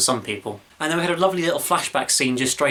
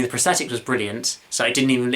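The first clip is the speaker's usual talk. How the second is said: ordinary delivery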